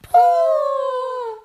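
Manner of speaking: very high, loud disgusted cry; crowd cry of disgust. Acted. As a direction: shriek it like a crowd of witches. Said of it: "cried the audience".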